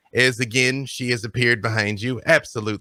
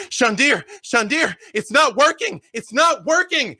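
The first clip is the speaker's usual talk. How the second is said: high-pitched desperate calling; acted panic, pitched way up; panicked cry; 13 semitones higher, 3 dB louder than usual. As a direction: cry out to the wizard as the spell fails. Panicked cry inside the vision.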